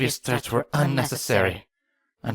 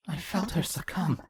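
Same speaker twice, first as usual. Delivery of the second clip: fading to a whisper; 5 semitones higher, 7 dB quieter than usual. Acted. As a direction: voice sinking down to almost nothing, softer. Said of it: "voice slowly descended into a whisper".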